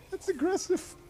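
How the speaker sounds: very high, rising through giggles; giggly high remark; amused squeak. Real, not acted. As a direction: laugh it out in disbelief. Amused at fast movement.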